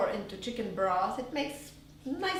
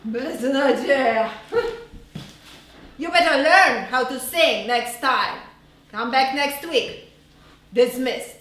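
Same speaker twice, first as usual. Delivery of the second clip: contemptuous teacher; 9 dB louder than usual. Retold or imitated. Teacher dismissing the child.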